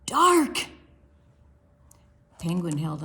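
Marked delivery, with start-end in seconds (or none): 0.0-0.7 slow, rising to a high loud dark; Ella peering into the closet; spooky. Acted. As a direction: build the suspense. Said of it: "Ella leaned in".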